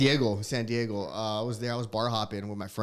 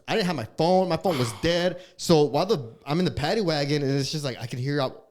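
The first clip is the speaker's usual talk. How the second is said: ordinary delivery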